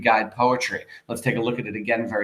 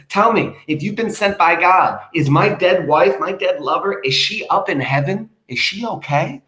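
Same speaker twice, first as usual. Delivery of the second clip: anxious plea; 6 semitones higher, 5 dB louder than usual. Retold or imitated. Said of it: "and he says".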